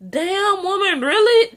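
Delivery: high, loud disbelieving retort; incredulous. Real, not acted. Reviewer reacting to Cora's threat, high disbelief. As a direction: call her out in disbelief. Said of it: "I'm like".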